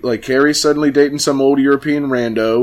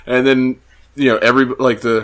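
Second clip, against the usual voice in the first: ordinary delivery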